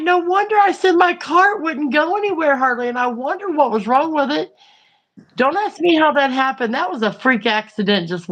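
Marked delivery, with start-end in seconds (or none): 0.0-4.5 much higher amazed realization; sudden dawning of why the cart stalled; realizing. Retold or imitated. To the clerk about the dragged rack, higher amazed. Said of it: "and I'm like".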